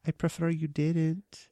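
high, posh-ish mock English child; a kid's put-on English accent; odd English accent for a movie kid. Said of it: "is that an English accent".

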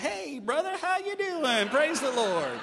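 high, bright mock churchgoer greeting, loud and sing-song; fake cheerful greeting. Churchgoer switching from yelling to fake cheer, high and loud. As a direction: put on a sudden sweet church smile in the voice. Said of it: "you're like".

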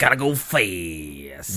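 low, stretched-out mock catchphrase; Sonic catchphrase. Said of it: "they're the Sonic faction".

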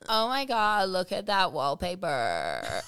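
slow, sinking LA vocal fry; mock LA accent. Mimicking makeover show hosts, LA vocal fry. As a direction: drag the end into an LA creak. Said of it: "L A accent".

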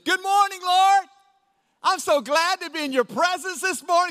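high, sugary mock-pious greeting; fake spiritual prayer. Pastor mocking a fake-pious morning prayer, high and syrupy. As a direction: overdo the holy voice to sound spiritual. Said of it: "make it sound more spiritual".

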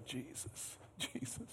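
breathy whispered Jesus, barely audible. Shown as a demonstration. Preacher showing the quiet way to call Jesus, whispered. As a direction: whisper his name like a private prayer. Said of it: "Or maybe it's this way".

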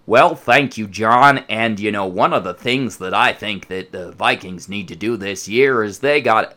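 imitating a stiff old-school broadcaster; lightly put-on booming commentator; mild mock sportscaster; mock announcer voice. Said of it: "it was the timbre".

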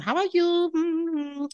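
put-on high-pitched French-accented greeting; [name]'s French accent. Facialist sitting down to greet client, high French accent. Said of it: "she's got a French accent".

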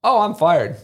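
high, knowing sigh of a quote; lightly voiced boss, resigned; a hint of the boss, resigned to being fired; mock resigned boss. Boss predicts his firing.

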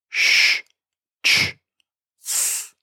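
sh, ch and s breathed with no voice; just air for sh, ch, s; unvoiced sounds. Shown as a demonstration. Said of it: "unvoiced consonant sound".

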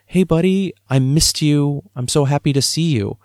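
father greeting son after work, delighted; happy greeting. Retold or imitated. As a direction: welcome him home like you missed him.

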